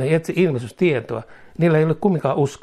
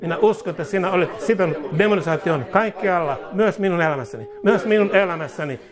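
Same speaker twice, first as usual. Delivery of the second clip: raised, pleading prayer voice; high-pitched fervent prayer; 5 semitones higher than usual. Real, not acted. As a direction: lead the flock in earnest prayer. Leads congregation in prayer.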